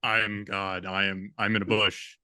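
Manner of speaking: mock God voice, pitched low; deep, hammed-up divine announcement; booming voice of God. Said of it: "it's a very booming voice".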